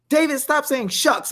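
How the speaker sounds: high-pitched imitation of a scolder; mock scolding. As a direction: lightly act out someone telling you off. Acts out people scolding him.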